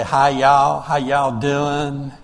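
slow, drawn-out Southern greeting; put-on Southern accent, stretched-out words; thick Southern accent. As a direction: imitate a heavy Southern accent. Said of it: "Southern accent".

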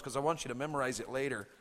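ordinary talk

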